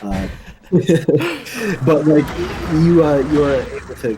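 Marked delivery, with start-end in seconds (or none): 0.3-1.8 high burst of laughter; embarrassed laugh. Real, not acted. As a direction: laugh it off, sheepish. Laughs at own stumbling.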